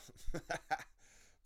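high, loose giggling laugh; real laugh. Real, not acted. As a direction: crack up at your own joke. Laughs at own joke.

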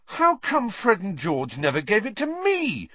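aggrieved younger brother; outraged. A narrator's reading. Ron complaining about his twin brothers, outraged.

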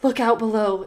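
ordinary delivery

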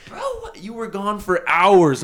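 dragging out the disbelief; imitated shocked friends. Friends telling him he vanished for hours, high and stunned.

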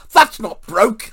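loud, high exclamation; wife's mock-outraged shout; retold outburst. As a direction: burst out with the verdict, high and very loud. Retold wife inspects thumb, exclaims.

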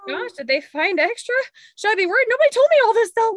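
dog owner panicking about a long surgery scar; mock panic. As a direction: build into fast frantic worry.

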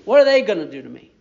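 "What are they gonna do to me?" rattled off fast, dismissive; fast defiant repeat. Shown as a demonstration. Believer shrugging off enemies, quick and defiant. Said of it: "you say".

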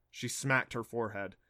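ordinary delivery

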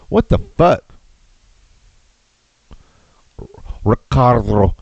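reading the article in a mock newscaster voice; news read. Streamer reading a goat story to viewers, mock newscaster. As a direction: ham up the news report, then break off.

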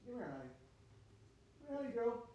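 hushed sweet calling of a dog; soft dog call. Retold or imitated. Retelling how he calls his dog Honey, soft coaxing. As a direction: coax the dog gently, quietly. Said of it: "they'll run with that".

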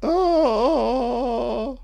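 long, high fake sob; mock crying wail. Mocking an actress attempting tears, hammed-up wail. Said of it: "she's gonna attempt a crying sequence".

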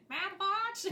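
high, rising, puzzled "Mad Watch?"; light imitation with a high questioning lift; March Hare question. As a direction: ask it like the March Hare, baffled. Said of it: "specifically his tone of it".

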